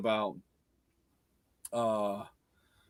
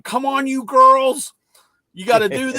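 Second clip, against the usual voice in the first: retold sideline yell; 12 semitones higher, 7 dB louder than usual. Parent yelling to a co-ed team of kids, retold holler. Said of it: "I was so used to yelling".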